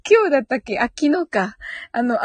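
ordinary talk, nothing marked

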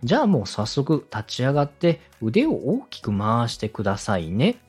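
usual speaking voice

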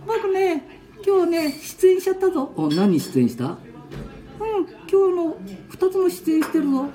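put-on high, playful little-boy voice; squeaky grandkid voice; child voice. Ken-chan boasting.

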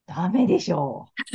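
loud, drawn-out mock retort; emphatic retort. Friend answering back as if it's obvious, emphatic retort. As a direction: imitate someone insisting, loud and stretched.

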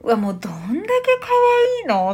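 high squealing gush; cooing over something adorable, pitched high; gushing over something cute. Shown as a demonstration. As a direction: squeal like you just saw a puppy. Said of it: "みたいな".

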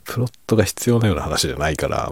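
ordinary talk, nothing marked

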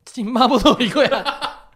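loud, eager and laughing; begging to go eat mapo tofu; pleading to go eat. Retold or imitated. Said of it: "って".

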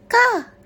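high, loud crow caw. A narrator's reading. Crow opening its beak to sing, high caw. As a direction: let out one high caw.

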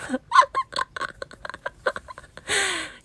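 long high peal of laughter; helpless, drawn-out high laughter; real laughing fit. Real, not acted. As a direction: crack up at your own rude remark. Own rude remark about actor's hair.

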